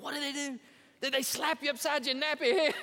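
high-pitched, incredulous outburst; mock outrage. Preacher reacting to her story on the radio, mock outrage. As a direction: demand it in exaggerated disbelief.

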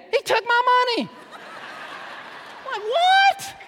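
laughing disbelief. Real, not acted. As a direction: laugh it off in mock outrage.

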